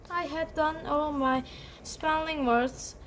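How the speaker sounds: usual speaking voice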